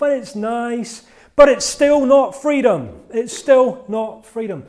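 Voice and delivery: slow and loud, drive the point home; emphatic slow cry. Retold or imitated. The pet bird insisting to itself, slow loud longing.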